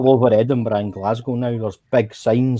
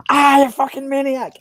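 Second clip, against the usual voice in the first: high, loud shriek of alarm at the driver; panicked yell; 3 dB louder than usual. Retold or imitated. Drunk mate yelling at the driver on the roundabout, shrieking in panic. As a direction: yelp it out like a mate grabbing the wheel.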